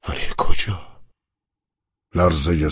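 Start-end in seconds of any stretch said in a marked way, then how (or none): 0.1-1.0 frightened whisper. Acted. Fear of death, inner question.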